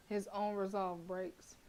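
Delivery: measured narrator read; story narration. A narrator's reading. Narrator describing the tense silence, story voice. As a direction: set the scene like reading a novel.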